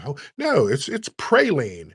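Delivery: "praline" said PRAY-leen, slow and stretched; mock corrector stressing "pray" in "praline"; correcting pronunciation. Outsider correcting Louisiana people, "praline" as PRAY-leen. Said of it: "trying to correct them people".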